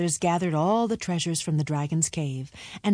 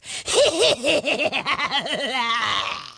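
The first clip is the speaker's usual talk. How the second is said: dying dragon laugh; 4 semitones higher than usual. A narrator's reading.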